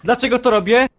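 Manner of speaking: loud, high indignant question; oburzenie. Acted. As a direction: throw the question back angrily. Odbija zarzut w kłótni. (głośno, z oburzeniem)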